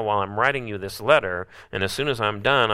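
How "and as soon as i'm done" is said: usual speaking voice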